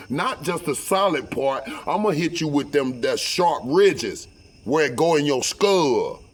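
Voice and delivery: high, heated refusal to calm down; retold threat, pitch easing down as it goes; angry ranting.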